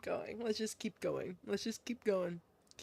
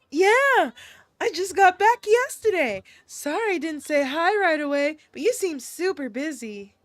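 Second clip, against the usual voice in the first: put-on higher, louder girlish reply; cheery girl character; 3 semitones higher, 5 dB louder than usual. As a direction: answer brightly in a higher voice.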